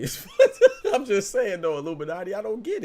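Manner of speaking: loud laughing remark. Real, not acted. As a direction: crack up while you say it. Laughing at a chat comment.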